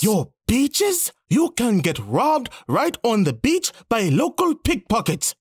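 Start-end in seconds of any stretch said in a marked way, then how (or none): 0.0-3.7 much higher, incredulous rise on beaches; scoffing disbelief. Acted. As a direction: scoff at the idea. Scoffing at rival's boast.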